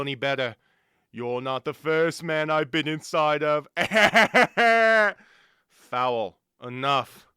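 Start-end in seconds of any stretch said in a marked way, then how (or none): 3.7-5.1 loud mock laugh. Spirit laughs at own joke.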